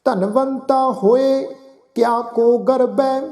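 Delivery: slow, devout chanting, each phrase drawn out; gurbani recitation. Acted. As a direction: chant the verse with reverence.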